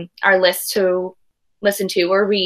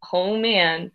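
ordinary delivery

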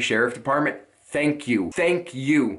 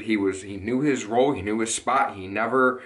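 ordinary talk, nothing marked

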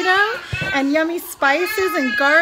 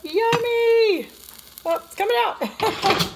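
excited; 9 semitones higher, 4 dB louder, slower than usual. Real, not acted. Big dish reveal.

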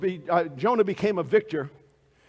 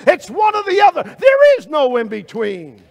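high, loud preacher's shout; shouted climax; 10 semitones higher, 14 dB louder than usual. Acted. Preacher shouting the choice at the congregation. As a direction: hammer the point home at full voice.

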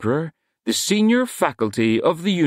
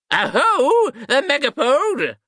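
hunting cry; 14 semitones higher, slower than usual. A narrator's reading. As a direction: call the chase across dark corridors. Wizards chasing the beast.

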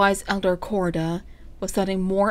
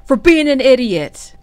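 loud, raised, drawn-out scolding shout; angry snap; 6 semitones higher, 11 dB louder than usual. A narrator's reading. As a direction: snap back hard at an underling. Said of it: "snapped Comfrey".

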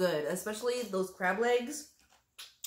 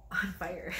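whisper; 4 dB quieter than usual. Real, not acted. Spicy sauce, mouth burning.

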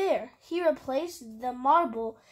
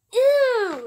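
high, loud squeal of disgust; drawn-out grossed-out exclamation; 10 semitones higher, 10 dB louder than usual. A narrator's reading. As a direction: recoil vocally from something gross. Acted disgust at a kiss.

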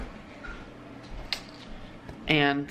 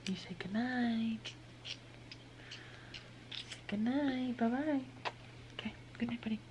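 sleepy talk to pet; 4 semitones higher, 14 dB quieter than usual. Real, not acted. Bedtime goodnight to baby.

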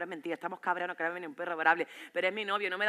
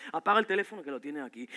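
lower-pitched command, not yet shouting; restrained order; 5 semitones lower than usual. Acted. Boyfriend orders her to silence phone, restrained order. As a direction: tell her firmly, holding back.

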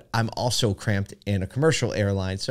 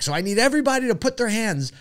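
upbeat flight attendant; 10 semitones higher than usual. Retold or imitated. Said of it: "She's like".

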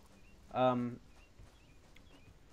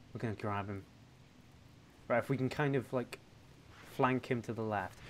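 ordinary talk, nothing marked

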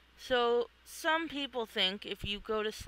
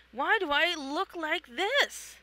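alarmed confusion; 7 semitones higher than usual. Acted. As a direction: panic about a strange body. Manic in strange form.

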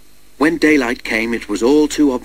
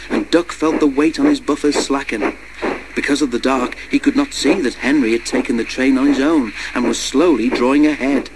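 plain reading voice

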